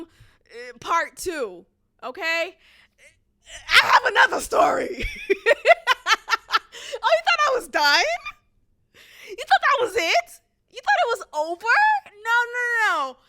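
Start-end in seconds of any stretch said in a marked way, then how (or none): 3.7-13.2 high, loud, laughing tease; excited teasing. Real, not acted. Teasing her viewers that the story isn't over, excited and loud. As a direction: playfully taunt the audience.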